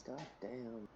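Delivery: mourning a dead character, voice sinking away; grieving. Real, not acted. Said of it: "Crying over a fictional character".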